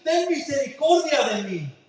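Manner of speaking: crying out. Acted. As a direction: call out to Jesus across the crowd. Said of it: "comenzó a dar voces". (grito suplicante)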